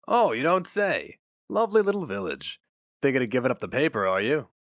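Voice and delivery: a hint of a polished personnel man; smooth interviewer. Dilling voiced by narrator.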